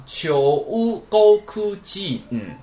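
秋乌沟哭基恩 slow and loud, each sound held; drawn-out syllables. Shown as a demonstration. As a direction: stretch 秋乌沟哭基恩 high, falling at the end. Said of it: "所以说连上". (秋乌沟哭基恩一个音一个音拖长高读，最后降调)

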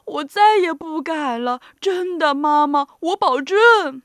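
loud, sobbing promise; hammed-up wail, slowing at the end; giant whimpering. A narrator's reading. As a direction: blubber and plead like a scolded child. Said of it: "巨人呜咽着说".

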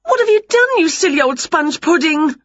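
very high, indignant wail. A narrator's reading. Winnie finds her cat transformed, high outraged wail. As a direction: start shrieky high, coming down through the insult. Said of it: "wailed Winnie".

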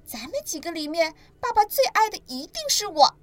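put-on a baby dinosaur showing off; smug baby dinosaur. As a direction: brag that you're dad's favourite. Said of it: "尼又得意地说".

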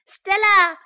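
high, loud name call. A narrator's reading. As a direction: shout for someone in another room. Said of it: "called Sam".

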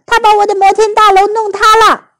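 put-on high, loud childish complaint; put-on child voice, crying foul; upset accusation. A narrator's reading. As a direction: tattle like a wronged little boy. Oliver blames Amanda for collapse.

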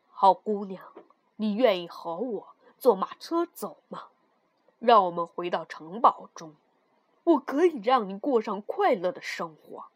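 low-pitched, kindly king; sympathetic. Acted. King moved by the girl's story, sympathetically. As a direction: offer help in a low, compassionate voice. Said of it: "同情地说".

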